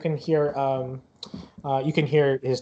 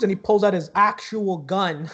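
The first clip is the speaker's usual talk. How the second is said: ordinary delivery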